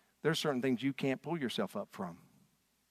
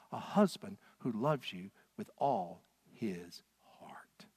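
slow tender whisper; husband whispering his love to a grieving Hannah; 5 semitones lower, 5 dB quieter, slower than usual. Retold or imitated. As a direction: breathe it softly to her. Tender husband's plea, intimate.

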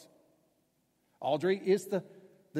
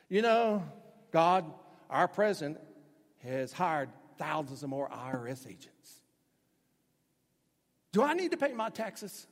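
mock prayer; 2 semitones higher, 7 dB louder than usual. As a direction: ham up a worried taxpayer praying. Mock prayer about taxes.

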